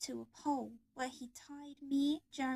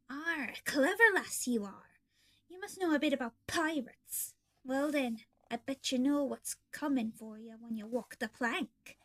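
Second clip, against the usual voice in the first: mocking pirate; 3 dB louder than usual. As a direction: sneer at the clever girl. Foxy mocks captive girl.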